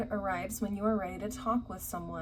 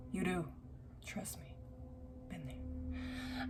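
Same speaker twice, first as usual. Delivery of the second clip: unvoiced confiding whisper; whispered aside; 11 dB quieter, slower than usual. Real, not acted. Reader confiding to viewers after her rant, whispered. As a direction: whisper it like a secret.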